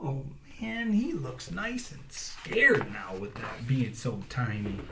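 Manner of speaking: ordinary delivery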